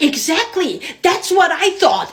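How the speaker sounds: high, loud, drawn-out retort; mock vindicated. Woman replying to attacker, believing a rescuer is behind her, vindicated. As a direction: say it triumphantly, stretching the words.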